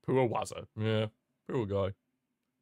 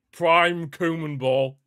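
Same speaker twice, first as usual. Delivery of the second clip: louder, slow hammed-up proclamation; louder mock call; 5 semitones higher, 6 dB louder, much slower than usual. Shown as a demonstration. Streamer proclaims the Koeman era, loud mock slogan. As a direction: announce it like a slogan. Repeats the mocking label.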